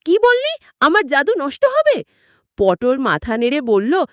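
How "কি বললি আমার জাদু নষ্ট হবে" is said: high, shocked gasping question; startled witch. Acted. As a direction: jolt up in alarm at the news. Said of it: "কুটনি ডাইনি আঁতকে উঠল".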